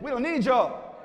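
high brush-off with conviction; mean it, punching the rejection; emphatic mock dismissal. Shown as a demonstration. Cool people told to mean it, emphatic mock rebuff. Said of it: "Say it like you mean it".

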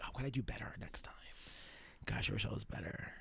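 hushed, whispered self-reproach; mock-regretful murmur to oneself; self-doubting whisper. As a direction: whisper it like private fretting. Acts out fretting over accents afterwards.

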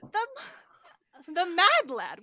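delighted exclaim. Retold or imitated. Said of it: "I was like".

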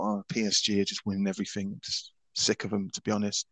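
low, quiet, fed-up aside; dismissive recalled thought. Retold or imitated. Host recalling why French football lost him, quietly fed up. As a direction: mutter it low, sick of it all. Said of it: "and I thought".

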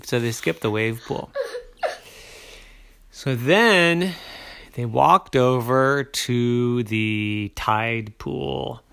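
ordinary delivery, nothing marked

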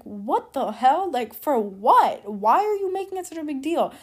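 mock angry retort. Answering teachers who threatened a report, loud exasperated retort. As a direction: fire back in disbelief at the teachers.

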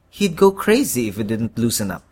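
plain reading voice

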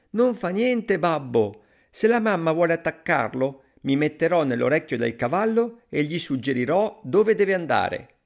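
put-on high, eager little-boy voice; Pollicino, confident. A narrator's reading. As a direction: explain the plan in a high child voice. Pollicino reassures his father. (bambino sicuro, vocina acuta)

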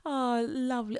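high, sing-song gentle mum voice; middle class mum. Retold or imitated. Mocking middle class mums talking to Tommy, sweet high coo. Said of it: "They're just like".

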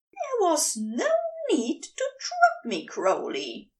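a ruffled angel complaining; high, huffy scolding; indignant angel. A narrator's reading. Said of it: "Aziraphale protests".

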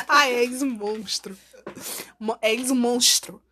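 és um monstro with the S as a sh sound, slow; Florianópolis accent. Shown as a demonstration. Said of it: "Troca o S por X".

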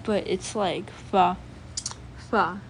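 fa said slowly through both lips; bilabial fa. Shown as a demonstration. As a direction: draw out fa with lips nearly closed. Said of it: "pretty similar to F".

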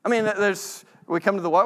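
ordinary talk, nothing marked

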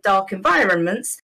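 ordinary talk

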